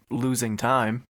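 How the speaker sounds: usual speaking voice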